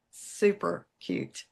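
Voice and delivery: normal talking voice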